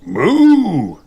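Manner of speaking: high loud mock moo; hammed-up bull call. A narrator's reading. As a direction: bellow a cartoon moo. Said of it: "shouts Mister Bull".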